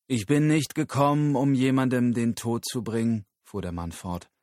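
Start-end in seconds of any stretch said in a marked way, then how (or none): 0.1-3.2 louder, raised, solemn declaration; proclaiming guru. Acted. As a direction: address the crowd like a prophet. Guru verkündet vor Publikum. (feierlich verkündender Guru)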